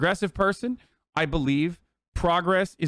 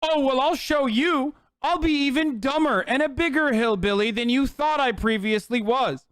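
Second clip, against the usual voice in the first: imitating someone stubbornly spiting their critics; mock defiant hillbilly; 5 semitones higher than usual. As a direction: drag out a mocking "I'll show you". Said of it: "what is their reaction to being called".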